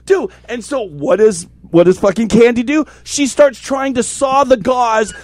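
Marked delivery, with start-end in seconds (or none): 0.0-0.3 screaming. Retold or imitated. Teller screams at his partners to finish the bandage. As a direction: scream at them to get on with it. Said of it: "I'm screaming at them".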